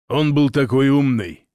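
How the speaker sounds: a hint of an eerie possessed voice; lightly voiced, someone else's voice; strange voice. A narrator's reading. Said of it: "сказал Ваня чужим голосом".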